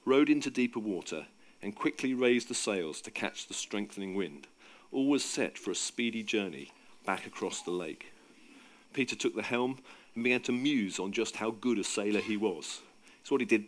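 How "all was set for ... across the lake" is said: plain reading voice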